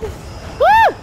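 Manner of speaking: high yelp of surprise; startled high-pitched woo; startled whoop. Real, not acted. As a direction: let out a sudden high woo. Startled while being attacked.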